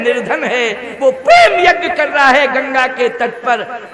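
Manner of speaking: loud, ringing devotee's declaration; impassioned proclamation. Acted. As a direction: cry out the message at full force. Kevat's impassioned message to Narad, acted.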